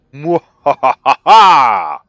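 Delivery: slow, booming villain laugh; evil cackle. Acted. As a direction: gloat with a big hammy cackle. Gloating as the enemy attacks.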